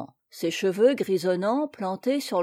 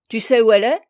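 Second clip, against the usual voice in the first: loud, eager question, sharply rising; lively exclamation; 9 dB louder, much faster than usual. A narrator's reading. Captain questioning the peasant about the lady, lively exclamation. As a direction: jump on the news with a loud question. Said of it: "s'écria vivement Plélan".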